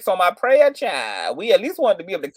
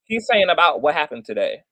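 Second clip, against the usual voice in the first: ordinary talk, nothing marked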